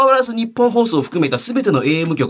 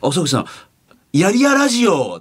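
forced cheer; 6 semitones higher than usual. Retold or imitated. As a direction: force out a bright greeting. Colleague's forced greeting.

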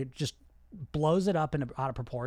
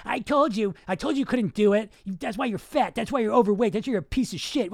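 louder, higher scolding; harsh inner critic; 5 semitones higher, 6 dB louder than usual. Acted. Critic in the head after a diet slip, scolding. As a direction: berate yourself like a mean inner critic. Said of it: "that's usually the voice people have in their head".

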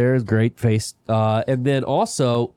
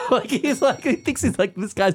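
laughing; 10 semitones higher than usual. Real, not acted. Laughing retelling.

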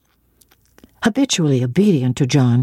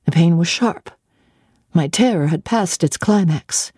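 plain reading voice

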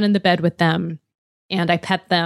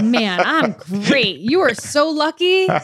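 mock boasting; 6 semitones higher than usual. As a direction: gush smugly over how great you are.

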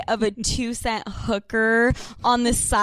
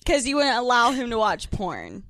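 ordinary talk, nothing marked

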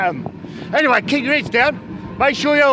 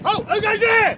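alarm shout; 9 semitones higher than usual. Acted. Sentry challenges newcomers in trench.

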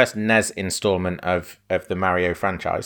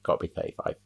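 unvoiced whisper under the breath; 3 semitones lower, 8 dB quieter, much faster than usual. Shown as a demonstration. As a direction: drop to a whisper to yourself. Repeats the line, then whispers.

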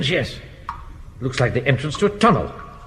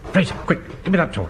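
usual speaking voice

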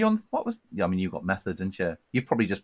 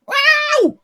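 very high shouted wow; [name] wow; 8 dB louder than usual. Retold or imitated. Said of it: "[name]-esque".

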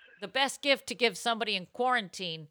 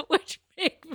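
talking through giggles, higher; laughing; 5 semitones higher than usual. Real, not acted. Host cracking up at questions coming in, laughing. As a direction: laugh your way through the words.

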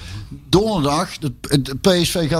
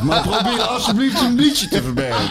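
laughing through the words; 4 semitones higher than usual. Real, not acted. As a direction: chuckle while complaining. Laughs recalling the radio commentary.

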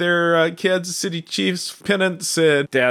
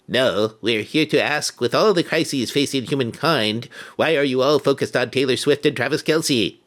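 a hint of an alien speaking. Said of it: "Nice alien voice".

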